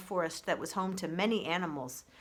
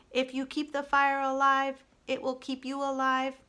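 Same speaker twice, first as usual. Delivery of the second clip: even, measured robot, a touch higher; calm robot voice; 6 semitones higher, 4 dB louder than usual. Acted. Roz the robot proposing peace to the animals, even robot voice. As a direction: speak like a steady, gentle machine.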